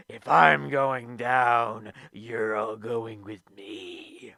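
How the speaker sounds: slow, low growled mock threat; Vincent Kennedy McMahon impression. Boss threatening to take everybody with him, slow mock growl. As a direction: drag out each word like a defiant boss. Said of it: "and basically say".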